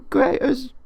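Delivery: high, loud mock Cockney; hammed-up British tea lover; British accent. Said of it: "crushed my cup of tea".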